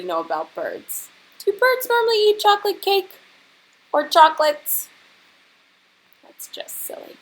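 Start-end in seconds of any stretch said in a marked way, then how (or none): 1.4-4.9 much higher, louder incredulous squeal; mock disbelief. Teacher asking the class if birds eat cake, incredulous. 6.2-7.2 breathy, much softer confidential remark; whispered aside. Acted. Teacher whispering to the class that it is silly.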